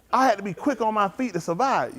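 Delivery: ordinary talk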